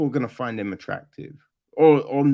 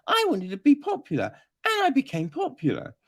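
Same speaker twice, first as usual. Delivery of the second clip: mock character; 8 semitones higher, faster than usual. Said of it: "It's just like".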